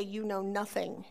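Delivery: put-on teenage disdain. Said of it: "the look that every teenager masters".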